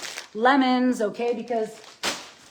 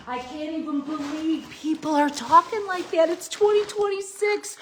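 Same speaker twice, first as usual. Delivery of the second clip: pitched way up in incredulous disbelief; scoffing at bad advice, voice climbing higher; exasperated disbelief; 6 semitones higher than usual. Real, not acted. Vlogger scoffing at people who avoid fruit, high incredulous disbelief.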